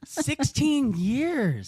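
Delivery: slow disbelieving gasp of a question; surprised. Real, not acted. Host reacts to long stay.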